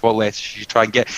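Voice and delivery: ordinary talk, nothing marked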